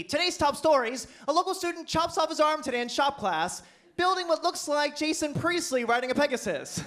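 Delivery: mock newscaster. Local news reporting the twin mix-up, mock anchor read. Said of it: "local news".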